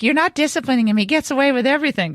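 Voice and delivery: upset daughter. Retold or imitated. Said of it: "Maria gets mad because she's like".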